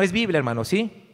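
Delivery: ordinary delivery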